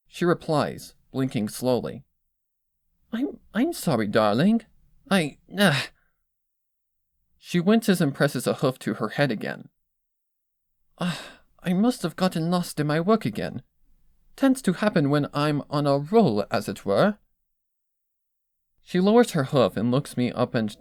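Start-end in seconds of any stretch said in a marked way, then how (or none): 3.1-5.8 higher stammering refined voice; flustered Rarity. Acted. Rarity apologizes to Twilight, flustered and stammering. 11.0-17.2 higher stammering refined voice; flustered Rarity. Acted. Rarity apologizes to Twilight, flustered and stammering.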